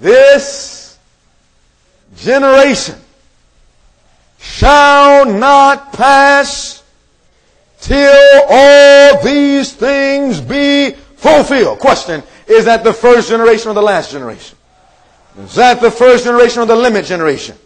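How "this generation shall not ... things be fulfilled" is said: very slow, high, loud proclamation; proclaiming scripture. Acted. Preacher reading out the verse to the congregation, proclaiming. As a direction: stretch every word out with long pauses.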